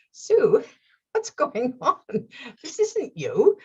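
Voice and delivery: a gentle talking-to from her sensible side; inner voice scolding. Retold or imitated. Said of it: "there's a part of me going".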